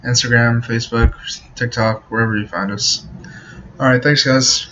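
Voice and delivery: usual speaking voice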